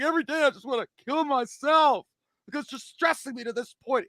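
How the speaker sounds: mock despairing wife. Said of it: "she's like".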